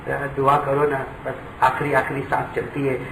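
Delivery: a quiet, broken request; hushed plea. Acted. Son outside ICU asks visitors to pray, hushed. Son begging prayers for dying father.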